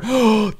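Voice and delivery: sharp high gasp of delight. Real, not acted. Gamer spotting his old panda, delighted gasp. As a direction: gasp as if you spotted a lost friend.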